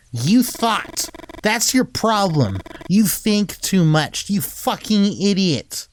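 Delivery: high, slow, biting rebuke; scornful scolding. Acted. Christine berating Jimmy for overthinking, slow scornful scolding. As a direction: spell out his stupidity with contempt.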